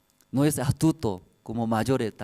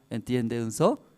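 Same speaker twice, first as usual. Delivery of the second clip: ordinary talk, nothing marked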